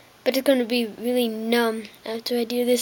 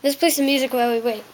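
ordinary talk, nothing marked

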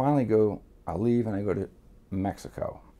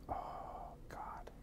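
silent dread; 22 dB quieter than usual. Retold or imitated. Retold dread at ID request.

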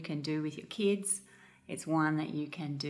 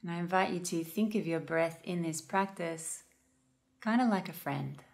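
calm, unhurried meditation guide with long pauses; guided meditation. Real, not acted. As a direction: gently guide listeners into noticing their breath.

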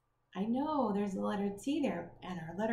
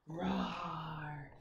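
dinosaur roar; 5 semitones lower than usual. Shown as a demonstration. Reader roars for children, low playful dinosaur roar. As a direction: growl like a friendly dinosaur. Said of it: "do your best dinosaur roar with me".

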